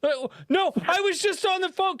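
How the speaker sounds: put-on high, nervous child voice; kid's high voice. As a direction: squeak out a scared excuse. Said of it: "They're like".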